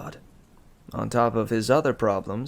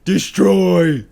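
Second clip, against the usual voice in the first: higher, very loud brute shouts; dim brute shouts; 6 semitones higher, 13 dB louder, slower than usual. A narrator's reading. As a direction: bellow each word like a proud oaf. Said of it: "Cal offered".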